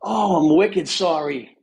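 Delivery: put-on Boston accent. Guest apologizes to the host in Boston accent. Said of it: "he's surprised that you didn't answer the questions in the accent".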